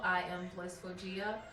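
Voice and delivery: usual speaking voice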